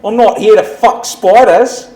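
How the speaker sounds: loud, slow Aussie shout; hammed-up Australian catchphrase; Aussie accent. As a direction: belt it like a rowdy Aussie bloke. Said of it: "Aussie saying in the accent".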